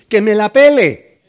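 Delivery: put-on loud, cheeky kid's retort; a child shouting back at his mother, loud and cheeky; cheeky child's shout. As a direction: blurt it like a rude little boy.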